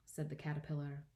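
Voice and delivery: ordinary delivery, nothing marked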